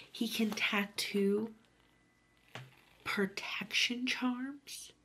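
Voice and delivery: slow whisper; hushed and awed. Real, not acted. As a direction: breathe it out slowly like a secret. Whispering along to the audiobook.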